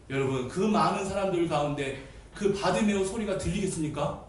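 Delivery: ordinary delivery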